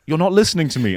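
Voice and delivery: a wife scolding the distracted husband. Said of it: "and it's like".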